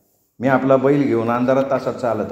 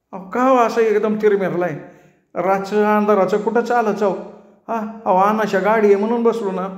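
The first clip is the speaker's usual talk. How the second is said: higher, whiny village voice; pleading barber; 8 semitones higher than usual. A narrator's reading. Barber begs the cart owner to let him ride, higher and whining. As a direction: beg like a put-upon servant.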